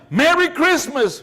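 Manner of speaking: ordinary talk, nothing marked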